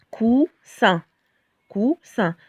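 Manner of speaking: "coussin" split as "cou-ssin" with a hissed S; S sound. Shown as a demonstration. Teacher modelling the double-S word, "coussin" with a clear S. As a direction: say "coussin" slowly in syllables, voiceless S. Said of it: "si on a deux S".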